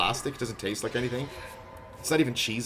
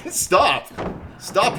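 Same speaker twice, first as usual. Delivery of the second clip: shouting stop; 7 semitones higher, 5 dB louder than usual. Real, not acted. Killer chasing him.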